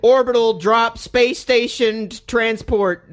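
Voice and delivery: slow, drawn-out mocking delivery; mocking name read-out. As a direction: spell out the silly title with slow disdain. Mocking a dumb game name.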